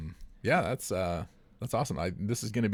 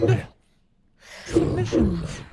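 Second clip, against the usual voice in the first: announcer call; 13 semitones higher, 9 dB louder, slower than usual. Acted. Show title sung out.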